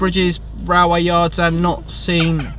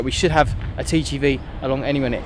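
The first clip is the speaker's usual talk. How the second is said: ordinary delivery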